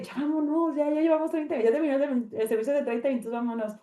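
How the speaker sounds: high-pitched retold complaint; impatient urging. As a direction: urge them to leave in a high, impatient voice. Retelling own impatient urging to leave.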